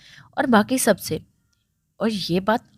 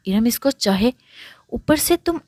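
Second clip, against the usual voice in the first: ordinary delivery, nothing marked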